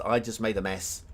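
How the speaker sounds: ordinary delivery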